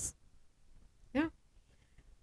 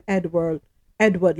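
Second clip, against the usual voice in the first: ordinary talk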